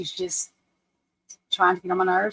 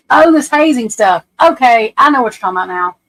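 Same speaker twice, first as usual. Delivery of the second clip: ordinary talk, nothing marked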